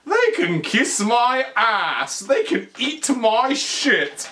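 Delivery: high, drawn-out hammy indignation; swearing film doctor. Retold or imitated. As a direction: imitate a scientist cursing slowly and grandly. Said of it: "Doctor Frankenstein swears".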